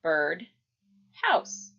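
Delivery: bird ... house, syllable by syllable; bird, pause, house; split into syllables. Shown as a demonstration. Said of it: "it becomes".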